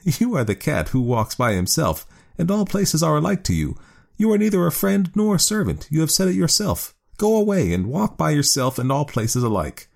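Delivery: high woman's voice, scornful. A narrator's reading. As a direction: tease him from on high. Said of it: "Then the woman laughed and said".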